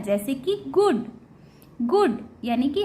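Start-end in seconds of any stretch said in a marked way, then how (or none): 0.7-1.1 गुड starting on a hard ग. Shown as a demonstration. Teacher showing learners hard G, गुड with ग. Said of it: "ग के रूप में भी".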